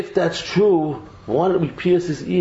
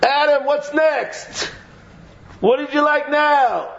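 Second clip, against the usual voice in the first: put-on high, slow, teasing child's voice; playful kid voice; 10 semitones higher, slower than usual. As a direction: voice the kids at the grill asking what's next. Voicing the children at the barbecue.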